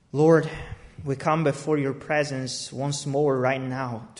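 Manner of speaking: low, soft, earnest prayer. Real, not acted. Pastor praying over the gathered church, hushed and earnest. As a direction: pray quietly and sincerely before the word.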